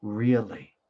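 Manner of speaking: really said alone, loud and falling; stress on really. Shown as a demonstration. Teacher isolates really for students. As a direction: hit the word really. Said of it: "the emphasis word".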